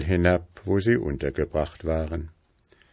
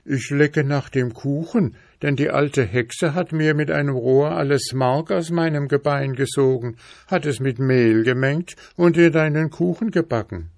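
higher, plaintive horse voice; talking horse; 8 semitones higher than usual. Acted. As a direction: let the horse explain its plight, pitched up. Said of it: "Da hub der Schimmel an zu reden".

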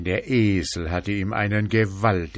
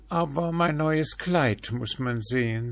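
higher, vain peacock voice; a peacock showing off; preening peacock; 7 semitones higher than usual. A narrator's reading.

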